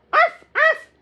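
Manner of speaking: high, yappy double bark; puppy bark. Acted. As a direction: yap twice like an eager puppy. Puppy answers from below.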